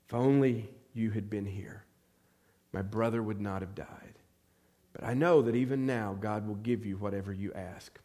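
lightly voiced mourner, low and unhurried; subdued grief. Grieving sister speaks to her good friend Jesus, subdued grief. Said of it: "from the grief and the tears".

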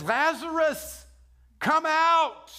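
Jesus calls Lazarus out of the grave in a loud booming command. Retold or imitated. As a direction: call the dead man out of the tomb at full voice. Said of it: "and in a loud voice".

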